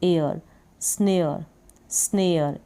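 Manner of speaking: teacher sounding out स्नेयर; snare pronunciation. Shown as a demonstration. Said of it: "एस एन को पढ़ा स्न".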